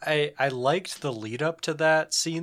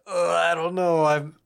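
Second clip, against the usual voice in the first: hesitant, higher, sheepish voice; timid backing out; 4 semitones higher, 3 dB louder than usual. Retold or imitated. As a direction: suddenly lose your nerve. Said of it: "just immediately pusses out".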